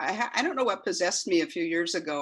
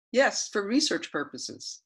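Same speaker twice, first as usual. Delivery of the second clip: repeating to voice assistant; 3 semitones higher, slower than usual. Acted. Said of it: "this is the transcript of that conversation".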